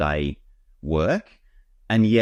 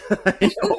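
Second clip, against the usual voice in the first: high burst of real laughter; bright laugh; 3 dB louder than usual. Real, not acted. Host laughing about Dirk's campfire obsession, big laugh. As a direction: laugh out loud at the thought.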